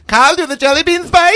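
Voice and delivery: put-on high, loud German mom accent; thick German accent. Redoing mom's line after being told to thicken the German accent. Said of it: "I don't think you're doing the accent thick enough".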